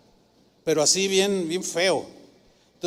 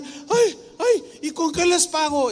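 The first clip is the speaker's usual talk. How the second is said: high, startled cry of disbelief; an overwhelmed woman gasping thanks; 9 semitones higher, slower than usual. Retold or imitated. Humble woman told her house is back, overwhelmed high cry.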